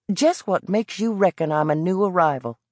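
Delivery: put-on suspicious Carolina kid pushing back; Southern boy. Boy from the Carolinas challenging the old vendor, Southern accent.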